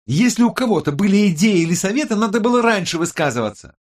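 high, fast, loud tirade; angry reproach. A narrator's reading. Tech expert scolds the team for late advice, angrily. Quarrel between the colleagues.

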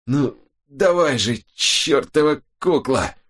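slow, high, effortful urging; straining curse. A narrator's reading. Robin fights a heavy stone, cursing it with effort. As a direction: drag the words out with exasperated effort.

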